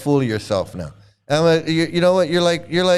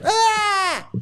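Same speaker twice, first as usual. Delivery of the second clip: short high fake scream of ecstasy; mock scream. Mocking devotees who fake ecstatic symptoms with a scream. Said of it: "People are faking it".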